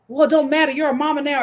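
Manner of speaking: ordinary talk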